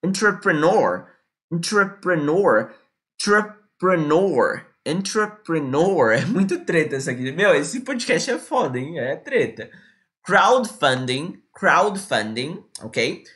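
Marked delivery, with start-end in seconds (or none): 0.0-6.1 "Entrepreneur" slowly, every syllable clear; "Entrepreneur" drawn out; slow pronunciation drill. Shown as a demonstration. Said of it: "pra vocês pegarem a pronúncia". 10.2-12.7 "Crowdfunding" very slow, rising; slow pronunciation drill. Shown as a demonstration. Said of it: "pra vocês pegarem a pronúncia".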